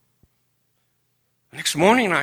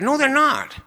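high, sharp flat-out no; sharp denial; 13 semitones higher, 4 dB louder than usual. Retold or imitated. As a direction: snap back at your son-in-law. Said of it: "I was rude, I was mean".

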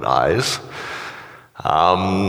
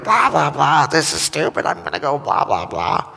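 hammed-up angry rant, higher pitch; mock rant; 7 semitones higher than usual. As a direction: play your own online tantrum, half mocking. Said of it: "these huge statements like".

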